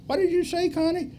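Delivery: tiny, very high squeak; imitating a timid student from far off; little bitty voice. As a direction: shrink the voice to a small frightened peep. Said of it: "in a little bitty voice".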